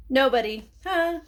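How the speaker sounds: loud, high jokey nobody; spring the joke on the kids; playful gotcha. A narrator's reading. Narrator tricking young listeners, loud playful gotcha.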